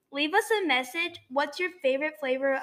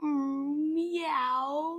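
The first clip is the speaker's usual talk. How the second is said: kitten voice; 2 semitones higher, 5 dB quieter than usual. Pretend. Answers with cat voice.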